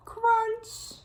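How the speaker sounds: high, squeaky crunch in a different voice; playful crunch noise; crunch sound effect. A narrator's reading. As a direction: make the leaves crunch.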